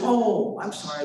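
imitated sweet apology, starting high; sweet-voiced lady. Lady in charge apologising to the patient, imitated sweetness. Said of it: "the sweetest accent".

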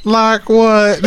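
mock Southern belle, high and loud. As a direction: imitate a tipsy Southern belle. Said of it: "she started talking like a Southern belle".

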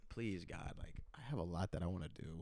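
soft, low mock prayer under the breath; retold inner plea. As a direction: quietly act out pleading with God in your head. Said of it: "just thinking to myself".

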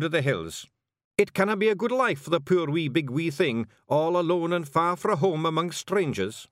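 high, loud Scots warrior; solemn Feegle vow; Scottish Feegle voice. Acted. Rob agrees.

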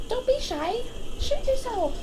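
high, soft, very slow coaxing; mock encouragement. Friend echoing the mock coaxing at the club, high and soft. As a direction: tease them sweetly to keep going.

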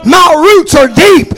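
roots punched, loud and slow; shouted declaration. Acted. Preacher defying the storm before his congregation, shouted slowly. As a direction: bellow it like a defiant oak.

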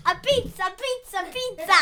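high, chanting mock Italian; playful sing-song fake Italian; put-on Italian accent. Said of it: "now we're using the Italian accent".

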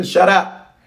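high, punched "Shut up"; sharp mock rebuke. Rebukes the mimicked doubter.

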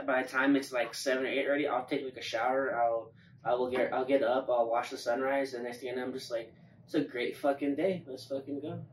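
ordinary talk

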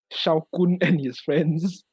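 slow, spaced out like a title card; slow title announcement. Acted. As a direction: announce it grandly and slowly.

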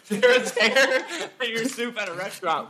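words through laughter; laughing. Real, not acted. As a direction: laugh while repeating it. Repeating question while laughing.

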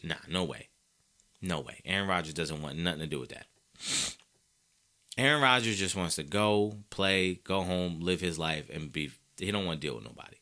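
soft, low dismissal. Real, not acted. Dropping voice at close.

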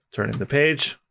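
usual speaking voice

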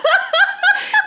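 squealing laugh that takes over; burst of high laughter. Real, not acted. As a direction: crack up high and loud. Laughing at the ghost joke.

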